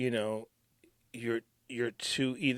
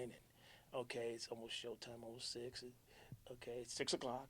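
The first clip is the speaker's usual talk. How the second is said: normal talking voice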